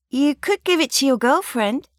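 flirty stallholder teasing a lad; cheeky suggestion. Acted. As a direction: tease him playfully. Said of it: "she suggested".

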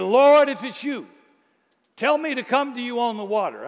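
eager Peter. Acted. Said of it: "impulsive Peter".

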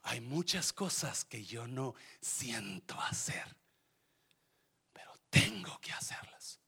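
soft hushed whisper; whispered confession. Acted. Pastor confiding to the church about duty, whisper. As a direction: confide it quietly. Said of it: "hushed confession of duty".

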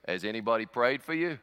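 imitated Australian guy; casual Aussie question; low-key put-on Australian accent. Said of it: "this big Australian guy".

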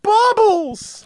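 high mock shriek, fading at the end; retelling Michael's shriek for Bubbles, very high; Michael's scream. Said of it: "I just remember Michael going".

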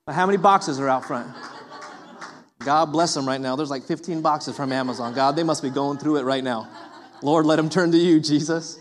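chuckling mock prayer through laughter; a laughing, low joke of a blessing. Real, not acted. Preacher jokes to congregation about Amazon boxes, laughing. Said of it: "jokes about neighbors' Amazon boxes".

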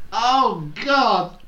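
high, loud oh God; exasperated surprise. Real, not acted. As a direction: groan it out, shocked. Reacts to tulips in October.

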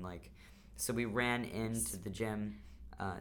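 normal talking voice

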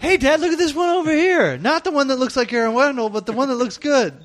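put-on high kid voice; bratty kid. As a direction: sing out like an eager kid at the tree lot. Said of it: "kid at tree lot".

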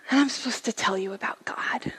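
whispered confession; nervous whisper. Retold or imitated. Aunt confessing on the phone, whispered. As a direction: hush it, unsure.

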